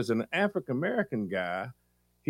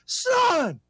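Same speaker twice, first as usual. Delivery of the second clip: much higher, loud cry of "Son!"; overjoyed greeting; 6 dB louder than usual. Acted. Host pretending a stranger is her son, overjoyed cry. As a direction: greet him like a long-lost child.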